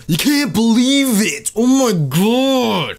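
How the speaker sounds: high, slow, stretched-out fake awe; milk the fake shock; hammed-up clickbait gasp; mock amazement. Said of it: "you say, you know".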